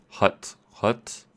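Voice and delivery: wh sounded as a throaty h: hut, hut; throaty mock Greek "hut"; Greek accent demo. Shown as a demonstration. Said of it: "it's in the back of your throat".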